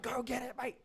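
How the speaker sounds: high-pitched mock alarm; alarmed cousin impression. Cousin stopping him nearing the fire, high mock alarm. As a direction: warn urgently in a falsetto. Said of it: "She's like".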